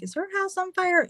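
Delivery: high, lightly voiced neighbor asking. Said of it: "They're like".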